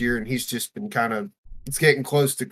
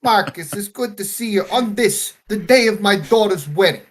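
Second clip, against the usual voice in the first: high, loud hammed-up mob boss; mock Jersey mobster; 8 semitones higher, 6 dB louder than usual. Mob father at his daughter's wedding, hammed-up Jersey accent. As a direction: play an indignant godfather, loud and raised in pitch. Put-on northern mobster bit.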